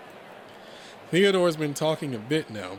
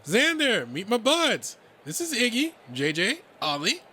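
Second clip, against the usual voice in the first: higher, louder, upbeat buddy voice; eager high-pitched introductions; excited Redline introducing friends; 10 semitones higher, 4 dB louder than usual. Acted. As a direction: call out names with lively energy.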